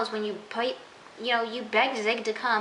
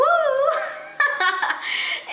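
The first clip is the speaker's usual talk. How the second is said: loud high laugh; 5 dB louder than usual. Real, not acted. Vlogger cracking up at the king's cheer, laughing loudly.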